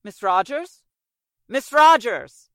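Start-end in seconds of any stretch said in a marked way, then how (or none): 1.5-2.5 loud, drawn-out call through a closed door; slow, loud call to someone behind a door. Acted. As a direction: shout her name so she hears you inside. Calling louder through the door.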